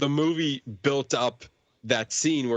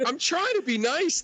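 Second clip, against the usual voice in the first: put-on high girlish voice. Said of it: "she was like".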